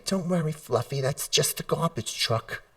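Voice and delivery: high soft coddling voice; an anxious owner cooing at a scared dog, high and soft; mock soothing owner. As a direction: coo at the dog like a fussing owner. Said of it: "So you stand there and you say".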